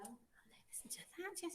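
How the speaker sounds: awed whisper; hushed, impressed whisper. Real, not acted. Teacher marvels at a pupil's French.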